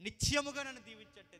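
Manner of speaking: high loud begging cry; crying plea. Acted. Jacob wrestling God, crying out loudly. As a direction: beg for the blessing at full voice. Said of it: "ఏడ్చాడు".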